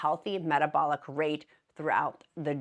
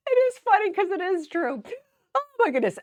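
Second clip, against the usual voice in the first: high, loud laughing words; 13 semitones higher, 5 dB louder than usual. Real, not acted. Still cracking up at the mustache joke, high and laughing. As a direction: squeal it out mid-laugh.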